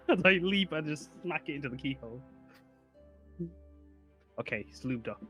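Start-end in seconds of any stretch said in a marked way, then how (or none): none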